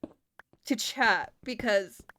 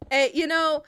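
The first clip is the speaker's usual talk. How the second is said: usual speaking voice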